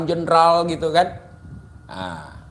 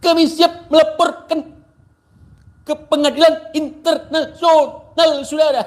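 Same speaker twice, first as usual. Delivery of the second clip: mock shouting preacher; 9 semitones higher than usual. Vlogger mocks rival cleric, high mock preacher shout. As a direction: ham up a screeching cleric crying persecution. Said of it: "jadi nggak usah teriak-teriak".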